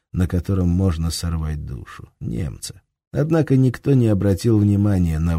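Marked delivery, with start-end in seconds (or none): none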